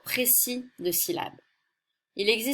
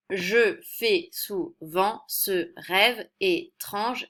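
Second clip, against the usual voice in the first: slow verse reading; much slower than usual. Shown as a demonstration. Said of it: "Ce sont des alexandrins".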